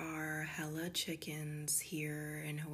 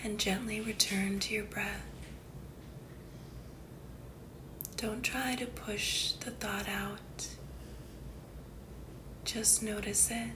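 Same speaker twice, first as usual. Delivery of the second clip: guided meditation; 3 semitones higher, 3 dB louder than usual. Acted. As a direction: guide the listener unhurriedly into calm, phrase by phrase.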